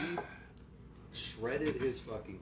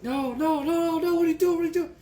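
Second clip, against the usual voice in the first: high, loud frantic cries of no; panicked pleading; 13 dB louder than usual. Retold or imitated. Boy begging his mom not to hand over his cards, high frantic pleading. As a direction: beg like a kid watching his stuff taken.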